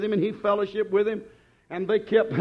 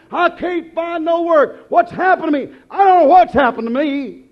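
mock despair; 8 semitones higher, 8 dB louder than usual. Worried believer voiced.

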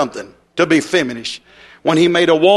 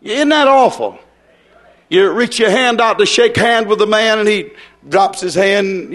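ordinary talk, nothing marked

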